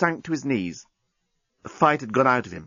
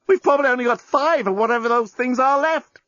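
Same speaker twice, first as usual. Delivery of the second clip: much higher, breathless frantic pleading; Lucy frantic; 10 semitones higher than usual. Acted. Lucy to the journalist with minutes left, high frantic and passionate. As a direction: gush it all out in a panic of passion.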